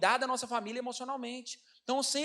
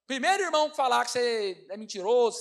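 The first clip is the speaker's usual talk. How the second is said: ordinary delivery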